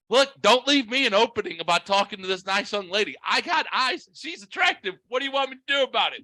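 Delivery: high-pitched, mock-indignant defence; retold argumentative protest. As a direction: plead your case like a playful squabble. Retelling the back-and-forth argument.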